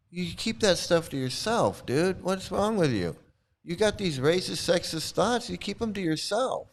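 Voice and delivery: high-pitched mock telling-off; exasperated scolding. Telling the guru to keep his thoughts private, high exasperated scolding.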